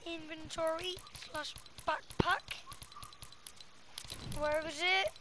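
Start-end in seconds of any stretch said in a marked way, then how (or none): none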